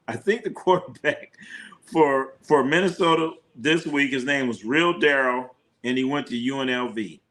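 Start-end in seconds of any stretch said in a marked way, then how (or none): 0.1-1.3 laughing. Real, not acted. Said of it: "restart with a laugh".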